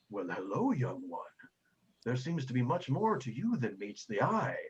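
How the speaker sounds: a lightly voiced gentle elder; benevolent priest. Priest figure voice.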